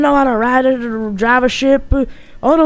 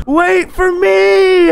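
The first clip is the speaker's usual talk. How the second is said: high, loud, drawn-out plea; desperate shout; 7 semitones higher, 4 dB louder, much slower than usual. Acted. Character left on the dock calls to the ship, desperate shout. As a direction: yell after the departing ship.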